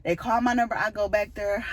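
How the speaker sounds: ordinary talk, nothing marked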